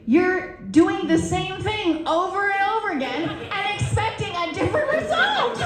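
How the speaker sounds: high voice climbing to a squeal; mock exasperation. Patient turning the advice back on her doc, mock exasperation.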